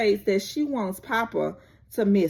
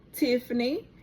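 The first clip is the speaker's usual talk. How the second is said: ordinary delivery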